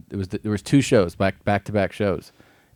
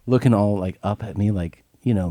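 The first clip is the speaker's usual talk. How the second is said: ordinary talk, nothing marked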